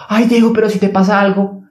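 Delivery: high, fretful pleading voice; the anxious mind begging him to stay; mock worried mother-like plea; worried inner voice. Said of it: "Esa es la voz otra vez".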